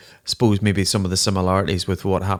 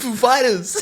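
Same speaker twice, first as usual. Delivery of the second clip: laughing echo. Real, not acted. Host echoes Walken's delivery laughing.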